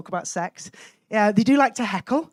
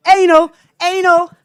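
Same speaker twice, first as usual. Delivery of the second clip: loud, higher-pitched shouted word; schoolboy heckles; 5 semitones higher, 9 dB louder than usual. Acted. Teenage boys going down like dominoes, shouted heckles. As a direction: pipe up like copycat hecklers. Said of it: "you just hear someone shout".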